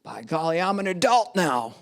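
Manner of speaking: mock declaration, punching the key words; hammed-up teenage independence speech; defiant eighteen-year-old. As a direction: make a big, indignant announcement. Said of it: "eighteen-year-old who's like".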